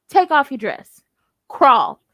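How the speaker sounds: loud, firm commands from the chest; no question about it. Shown as a demonstration. Showing him how to order her, firm and unquestioning. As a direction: demonstrate a sure, forceful order. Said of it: "Don't have no question about it".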